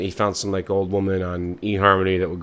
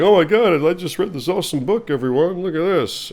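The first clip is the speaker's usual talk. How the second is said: higher, louder gushing; excited nerd; 10 semitones higher, 5 dB louder than usual. Acted. Nerd announcing his book to passersby, excited. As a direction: show off the book to everyone.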